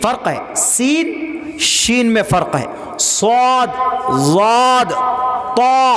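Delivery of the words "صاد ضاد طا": "صاد، ضاد، طا" said thick, full and held long; heavy letter articulation. Shown as a demonstration. Teacher drilling heavy letters, "صاد، ضاد، طا" thick and isolated. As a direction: round out "صاد" and "طا" with heavy back-of-tongue emphasis. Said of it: "ایک موٹا کر کے پڑھتے".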